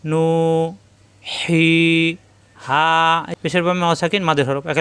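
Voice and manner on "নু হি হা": নু হি হা each with the vowel held long; stretched syllables. Shown as a demonstration. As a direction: draw out each syllable for one alif. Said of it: "এক আলিফ টেনে পড়তে হয়".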